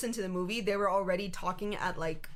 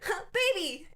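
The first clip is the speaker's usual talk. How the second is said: put-on high, laughing squeal at the baby; mock delighted girl; 9 semitones higher, slower than usual. Imitating the girl in the theater, high and giggly. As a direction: coo it like a delighted kid. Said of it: "the little girl's like".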